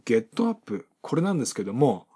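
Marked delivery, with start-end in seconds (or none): 0.1-0.9 "ゲットアップ" as ge-tto a-ppu, unlinked; Japanese-style "ゲットアップ"; katakana English. Shown as a demonstration. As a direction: say "ゲットアップ" in Japanese syllables with a hard T. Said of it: "多くの方がご存知の".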